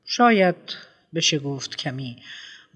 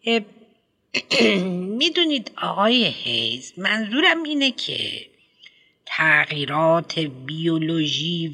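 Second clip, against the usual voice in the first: higher, hesitant school principal full of ums; a pompous headmistress lecturing a parent; prim schoolmistress voice, raised pitch; fussy headmistress; 3 semitones higher than usual. Acted.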